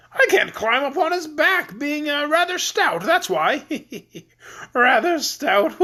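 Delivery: high, jolly voice of a stout king; fat jolly king. Acted.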